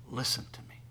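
hushed whisper of Listen to me; whispered plea. Acted. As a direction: breathe Listen to me softly, intimately. God's words repeated, then shouted.

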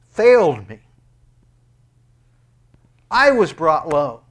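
loud, high, slowed preaching cry; full-force preaching. Acted.

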